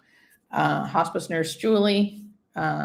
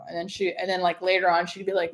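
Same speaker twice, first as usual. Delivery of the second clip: ordinary delivery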